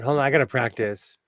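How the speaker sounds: ordinary talk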